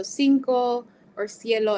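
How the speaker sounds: "cinco or cielo" with a clear S sound at the start; "cinco or cielo" slowly, with S at the start; Spanish soft C. Shown as a demonstration. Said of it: "more of that S sound at the beginning".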